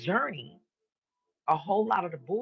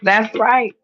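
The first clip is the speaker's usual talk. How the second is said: loud, higher, fierce affirmation; emphatic shout; 6 semitones higher, 14 dB louder than usual. Real, not acted. Preacher shouting agreement at his own point, loud. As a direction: belt it out like a sermon amen.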